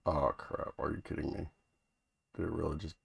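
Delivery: quiet, annoyed under the breath; exasperated mutter. Real, not acted. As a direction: grumble to yourself at the glitch. Software glitch loses the border.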